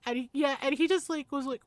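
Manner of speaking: ordinary delivery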